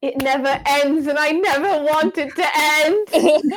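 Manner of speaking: high, drawn-out mock lament; hammed-up delight at endless wine; mock-dramatic wail. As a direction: wail it theatrically, stretching the words out. Playful cry over more wine.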